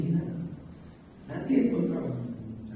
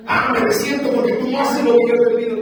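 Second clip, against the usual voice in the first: mock whiner; 11 semitones higher, 10 dB louder than usual. Mock complaint voiced to God.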